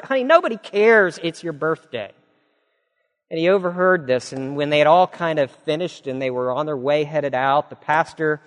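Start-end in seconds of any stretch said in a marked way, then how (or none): 0.0-2.1 high, dismissive sneer; mocking ridicule. Woman at the diner mocking Mabel, scornful. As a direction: brush her off with scorn. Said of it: "started to ridicule her".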